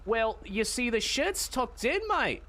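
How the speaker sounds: lightly voiced London geezer; cockney accent. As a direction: put on a cockney bloke. Improvised cockney bit, mate.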